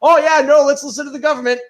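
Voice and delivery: 높은 목소리로 비꼬듯이; mock naive agreement; sarcastic, high-pitched. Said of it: "be like".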